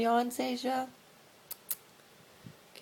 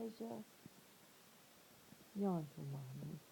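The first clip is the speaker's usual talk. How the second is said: hushed, gentle coaxing to a pet; soft coaxing; 15 dB quieter than usual. Real, not acted. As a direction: quietly coax the cat. Softly coaxing cat at night.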